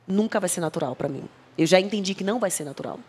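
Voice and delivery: ordinary talk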